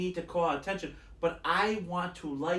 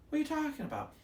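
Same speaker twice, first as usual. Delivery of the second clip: very high, softer mock bewilderment; imitating bafflement; 10 semitones higher, 7 dB quieter, much faster than usual. Companion still lost about the original film, imitated bafflement. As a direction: mimic total confusion in a high, lighter voice.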